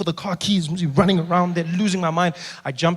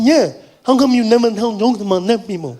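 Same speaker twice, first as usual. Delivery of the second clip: higher voice imitating a deaf man's accent; partially deaf speech; 4 semitones higher, 5 dB louder than usual. Fan admits he's partially deaf, higher deaf-accented voice. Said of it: "thought you had an accent".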